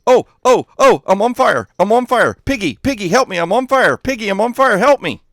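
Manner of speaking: high, loud mock panic; yelping high cries for help; Dadcraft. Streamer mimicking a friend on fire, high frantic yelling. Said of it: "Here's my imitation of Dadcraft".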